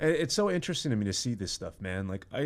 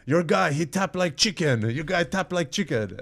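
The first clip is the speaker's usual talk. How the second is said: slow, higher, louder mock Russian accent; hammed-up Russian accent, drawn out; Russian accent impression; 7 semitones higher, 5 dB louder than usual. Fighter taunting Rogan's guest, in a hammed-up Russian accent. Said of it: "butchering the Russian accent".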